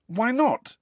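high, sharp question; challenging. A narrator's reading. Harry challenges Nick's explanation, demanding. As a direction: demand it, voice leaping up.

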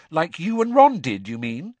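pointed Hermione. Acted. Hermione needling.

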